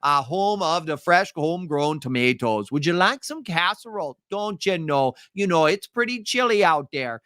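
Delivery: a sing-song Minnesota host with long vowels; Minnesota accent. Acted. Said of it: "They would more think of".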